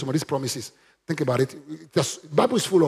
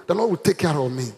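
firm, full-voiced statement; bold declaration. Shown as a demonstration. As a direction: say it boldly and steadily. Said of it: "Say that boldly now".

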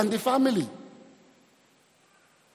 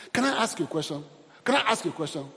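ordinary delivery

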